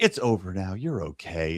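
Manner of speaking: low retold reassurance; soothing. Friend comforting man after the quarrel, soothing. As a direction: imitate calming someone down, pitched well down.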